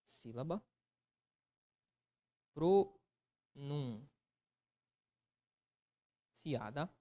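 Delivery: quiet, word by word with long gaps; slow writing-along. Real, not acted. Teacher writing out the definition, very slow and soft. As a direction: murmur each word as you write it.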